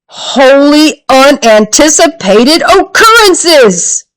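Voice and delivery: grand exclamation. A narrator's reading. Superhero-style exclamation.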